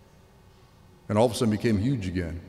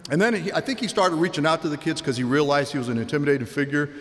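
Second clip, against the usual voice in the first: normal talking voice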